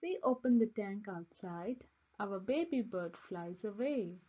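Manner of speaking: ordinary delivery, nothing marked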